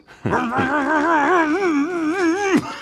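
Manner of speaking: loud, high burst of laughter; big laugh; hearty laugh. Acted. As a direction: laugh at his own line. Laughs at his own joke.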